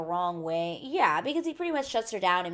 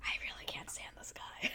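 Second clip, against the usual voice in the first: hushed, confessional whisper of dislike; secretly whispered grumble; whispered aside; 12 dB quieter than usual. Real, not acted. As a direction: whisper it under your breath. Confiding dislike of a contestant.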